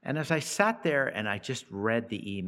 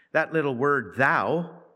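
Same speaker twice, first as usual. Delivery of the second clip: "thou" higher and louder, rising; emphatic thou; 4 semitones higher, 5 dB louder than usual. Shown as a demonstration. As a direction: lift "thou" so it jumps off the page. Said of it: "is emphatic".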